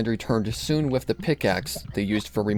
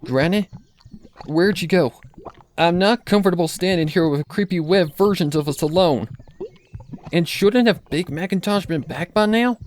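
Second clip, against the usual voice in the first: higher, louder nervous calling; scared; 7 semitones higher, 4 dB louder than usual. Acted. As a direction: call out nervously into the empty farm. Applejack alone, scared.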